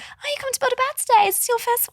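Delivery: very high-pitched shop greeting; customer service voice. Retold or imitated. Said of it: "that's my customer service voice".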